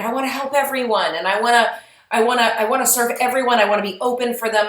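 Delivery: acted eagerness to please; eager helper. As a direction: gush a little, wanting to help everyone. Voicing an eager, overcommitted helper.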